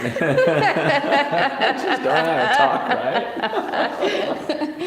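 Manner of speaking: squeaky laugh through the words; can barely talk for laughing. Real, not acted. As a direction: giggle out every word, very high and slow. Laughing at his own accent.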